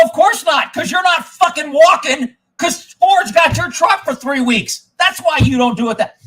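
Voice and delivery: retold angry shout; furious yell. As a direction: blast it at the dealer. Retold fury at Ford.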